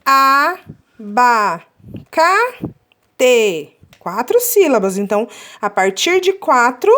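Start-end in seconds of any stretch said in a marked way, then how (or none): 0.0-1.6 "Abacate" counted out as a, ba, ca, te; long pauses between the syllables of "Abacate". Shown as a demonstration. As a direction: count the four beats of Abacate slowly. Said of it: "Vamos contar".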